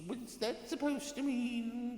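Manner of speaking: high, slow, offended cat-man voice. Retold or imitated. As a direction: play the affronted Caitian, drawn out. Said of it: "Gets all offended".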